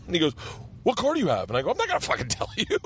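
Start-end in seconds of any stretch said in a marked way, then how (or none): 0.8-1.5 incredulous. Retold or imitated. Retold security guard question. 1.7-2.8 squeaky high, fast, laughing through it; giggling refusal, voice jumping way up; laughing defiance. Retold or imitated. Retold defiant reply, laughing.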